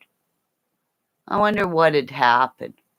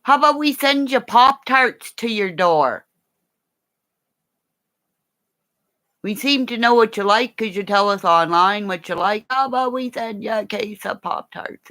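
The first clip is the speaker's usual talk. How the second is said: mock sweet offer; 7 semitones higher, 4 dB louder than usual. Mocking a sweet sales pitch.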